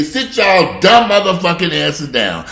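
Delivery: booming slow shouted command; slow loud mock order to sit down; mock presidential order. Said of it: "the president to come on out there and say".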